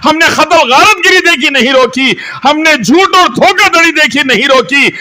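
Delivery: high, loud, hammering sermon delivery; impassioned litany, shouted high; fiery preaching. Real, not acted. Preaches at full force.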